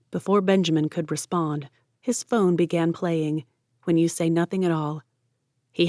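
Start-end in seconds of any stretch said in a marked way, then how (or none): none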